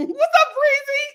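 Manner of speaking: high-pitched squealed hello; excited greeting. Real, not acted. Calling out a greeting to viewer.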